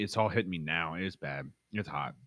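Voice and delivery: quiet strained burn; mouth burning from spicy food. Real, not acted.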